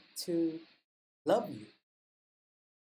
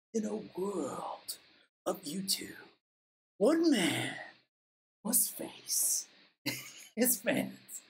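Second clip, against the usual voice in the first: mock trailer voice; much slower than usual.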